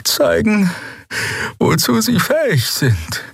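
slow, high, halting character voice; faint, struggling speaker. Acted. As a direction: speak slowly and high, barely keeping upright. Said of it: "schien sich nur mit Mühe auf den Beinen halten zu können".

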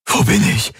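high, panicked gasp. A narrator's reading. Disoriented man waking among strangers, gasping. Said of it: "keuchte er".

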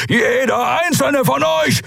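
slow, high-pitched roar; thundering shout. A narrator's reading. Keru threatens the attackers, thundering shout. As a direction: roar the threat, drawing out each word. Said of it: "donnerte Keru".

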